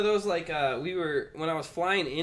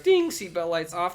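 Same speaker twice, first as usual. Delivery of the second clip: flight attendant voice; 5 semitones higher, 7 dB louder, slower than usual. Retold or imitated. Imitating plane announcement.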